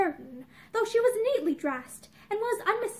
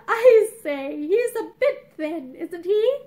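dubious; 6 dB louder, slower than usual. A narrator's reading. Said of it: "he asked dubiously".

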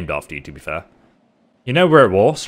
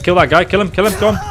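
higher and louder, egging the troops on; excited urging; 9 semitones higher, 7 dB louder, faster than usual. Real, not acted. Gamer urging his soldiers at an enemy, high and loud. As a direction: shout them on to the kill.

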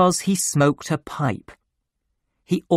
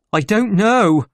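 higher, louder, baffled voice; bewildered reply; 7 semitones higher, 6 dB louder than usual. Acted. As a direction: answer emphatically, at a loss. Bewildered doctor answers after fright.

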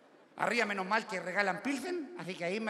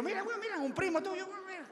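high, teasing point-out; 12 semitones higher, 4 dB quieter than usual. Retold or imitated. Guaso teases the Japanese man, high and mocking. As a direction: nudge your neighbour, high and mocking. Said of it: "y yo le digo".

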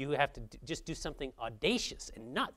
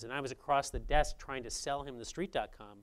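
ordinary talk, nothing marked

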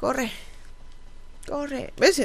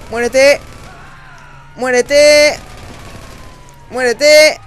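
shouting; 3 semitones higher, 7 dB louder, much slower than usual. Real, not acted. Shooting at game enemy.

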